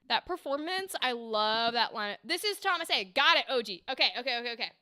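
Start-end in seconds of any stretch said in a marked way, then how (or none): none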